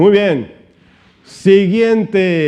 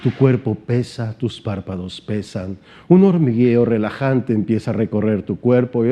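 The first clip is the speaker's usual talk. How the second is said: stage hypnotist impression; 7 semitones lower than usual. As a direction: lull them into a trance, deep and measured. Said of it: "¿Cómo hablan?".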